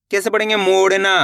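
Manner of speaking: usual speaking voice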